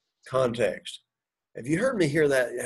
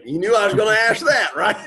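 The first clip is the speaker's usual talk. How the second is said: high, laughing tease; real laugh rising through the words; amused teasing; 7 semitones higher, 4 dB louder than usual. Real, not acted. Teacher teasing a student about the next question, laughing.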